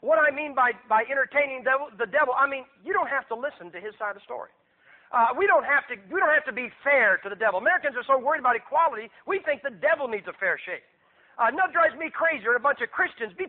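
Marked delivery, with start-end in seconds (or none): none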